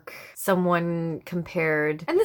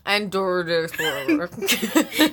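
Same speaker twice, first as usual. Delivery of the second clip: laughing; slower than usual. Real, not acted. Laughing at accent attempt.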